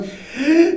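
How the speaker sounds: sharp high shocked gasp; sudden high intake of breath. Retold or imitated.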